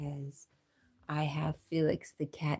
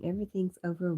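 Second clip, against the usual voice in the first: ordinary talk, nothing marked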